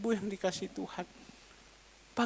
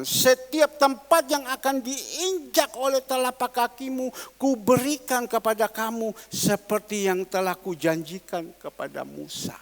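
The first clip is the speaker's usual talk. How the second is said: scripture repeated; 3 semitones higher, 5 dB louder than usual. Acted. Preacher repeats the verse to the congregation, forceful. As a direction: proclaim it again, firm then trailing off.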